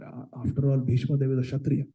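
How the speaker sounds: usual speaking voice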